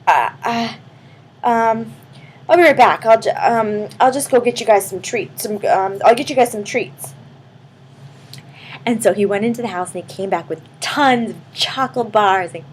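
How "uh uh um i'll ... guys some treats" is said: a touch of a flustered, stammering man; shaken up. Acted. Man just turned back from a hamster, mildly flustered stammer. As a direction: stammer it out, rattled. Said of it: "he was so shooken up".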